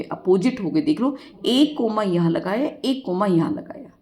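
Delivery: normal talking voice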